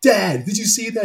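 high-pitched mock kid excitement; excited kid. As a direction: blurt it out like an overjoyed kid.

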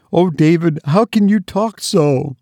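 high, tearful mother's plea; sobbed. Acted. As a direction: cry out at him through tears. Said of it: "sobbed her mother".

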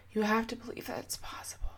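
whispered, close and soft; intimate whisper. Acted. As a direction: breathe it to them like a secret. Whispered encouragement to listener.

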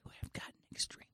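hushed teller whispering back. Acted. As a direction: answer in a voiceless whisper. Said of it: "when somebody starts to whisper".

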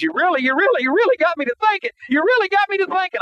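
high, choked-up words through tears; retelling a trucker moved to tears, high and tearful. Retold or imitated. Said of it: "I could hear tears in his voice".

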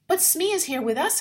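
high, loud urgent cry. A narrator's reading. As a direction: insist it out loud. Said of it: "I cried".

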